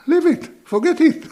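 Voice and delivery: high, loud dismissive reply; brushing it off. Retold or imitated. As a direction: wave the money away, insistently. Retelling his own generous reply.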